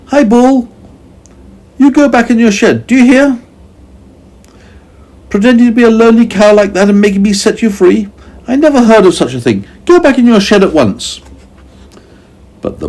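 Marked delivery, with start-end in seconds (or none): 0.1-11.2 high, loud, fast bossy scolding; ordering the bull. A narrator's reading. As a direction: tell the beast off like a naughty child. Meddle orders the bull back.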